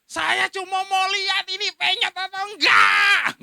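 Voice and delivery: angry shout. Retold or imitated. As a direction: shout it back at the driver, word by word. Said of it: "Saya dengan marah saya bilang".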